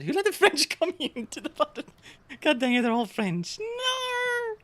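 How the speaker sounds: rising into a high no; mock despair. Real, not acted. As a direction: howl at the game in mock anguish. Despairing at French crew.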